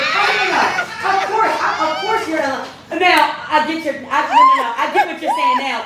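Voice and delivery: loud and high, laughing as it dawns on her; excited laughing outburst. Real, not acted. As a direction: burst out laughing in realization. Sudden realization, laughing outburst.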